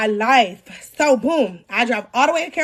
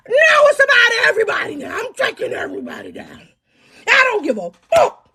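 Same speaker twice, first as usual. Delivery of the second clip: furious shout; 8 semitones higher than usual. Retold or imitated. Woman yelling back at the man who hurt her, crying shout. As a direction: yell that everyone is going down.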